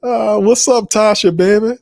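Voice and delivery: high, sing-song hello to a friend; playful high greeting. Real, not acted. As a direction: tease her name up high.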